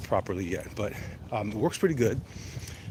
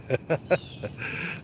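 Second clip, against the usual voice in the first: amused chuckle; light, high chuckling; real laughing; 5 semitones higher than usual. Real, not acted. Laughs at viewer's joke.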